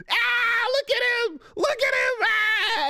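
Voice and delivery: put-on high, loud jeering shout of a bratty kid; mocking loudmouth kid. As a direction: taunt like a schoolyard loudmouth from the lift. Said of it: "fucking Stefan going".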